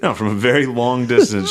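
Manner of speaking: usual speaking voice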